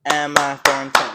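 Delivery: clapping out syllables in water park; slow syllable counting. Retold or imitated. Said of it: "I always go".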